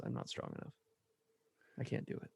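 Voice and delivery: whispered, very soft confession; husband whispering his weakness to his wife. Retold or imitated. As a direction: barely breathe it out, ashamed. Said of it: "he just gets really quiet".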